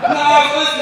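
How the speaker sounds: loud, high, still laughing; real laughter spilling into speech. Real, not acted. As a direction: push the words through laughter. Laughing after a laugh burst.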